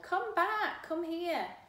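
loud high calls across a field; calling out. Acted. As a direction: call him back from far away. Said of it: "I called".

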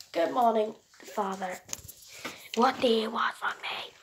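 ordinary talk, nothing marked

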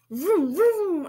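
loud, high revving vrooms; car engine noise. A narrator's reading. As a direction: rev up like a toy car. Child imitates a car.

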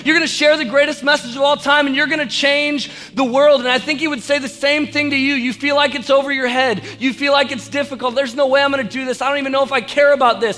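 high, loud, driving sermon voice; full-force preaching. Real, not acted.

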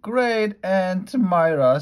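excited announcement. Acted. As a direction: announce the guest in a flurry. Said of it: "said Mister and Missus Rogers".

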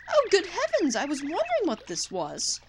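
high, loud gasp of a pompous British gent; startled posh explorer. Acted. Explorer mistaking a whale for a tuna, posh surprise. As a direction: bluster in amazement, old chap.

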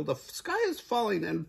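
very high chick, rushing as it builds; frantic Chicken Little. A narrator's reading. Chicken Little warns the goose the sky is falling, squeaking frantically. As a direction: climb into squeaky, hurried panic. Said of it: "rasped Chicken Little".

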